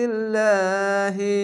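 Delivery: ইনদিল্লাহি read slowly with the long া held one alif; slow tajweed reading. Shown as a demonstration. Teacher reciting the word for students, slow and stretched. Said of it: "খাড়া জাবারে এক আলিফ টান পড়ে হি উচ্চারণ".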